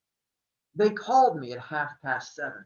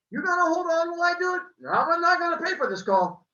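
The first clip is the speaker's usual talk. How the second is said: put-on high, loud accented shopkeeper; Italian accent, bookseller answering; thick accent; 13 semitones higher, 7 dB louder, faster than usual. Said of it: "His accent was thick".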